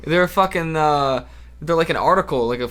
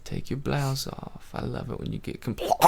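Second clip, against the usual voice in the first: sleazy seducer; 4 semitones lower, 13 dB quieter than usual. Pretend. Coaxing someone to take a blouse off, mock-sleazy murmur. Sleazy undressing bit in character.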